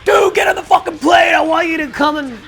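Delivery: imitated high, loud shouted demand; hammed-up high-pitched rock star shouting. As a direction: yell an urgent order down the phone. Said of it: "He's like".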